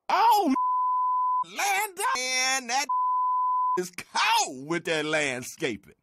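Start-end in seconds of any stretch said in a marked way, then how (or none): 0.1-4.7 high, surprised gasp at the name. Acted. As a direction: react with shocked surprise.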